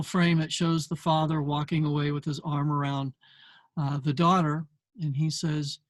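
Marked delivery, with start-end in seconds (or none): none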